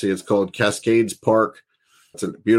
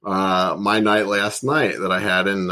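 ordinary talk

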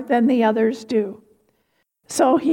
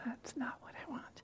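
whisper; 26 dB quieter, faster than usual. Real, not acted. Off-mic aside during recording.

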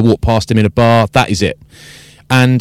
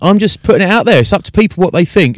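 high, mocking sing-song of a denial; mock defensive excuse; 9 semitones higher than usual. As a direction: parody the innocent excuse, pitched up. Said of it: "the common defense there is".

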